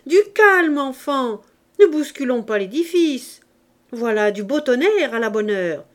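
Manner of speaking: put-on thrilled street-kid bravado; Gavroche delighted. A narrator's reading. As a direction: revel in the storm, loud and cocky. Gavroche laughs at the thunder.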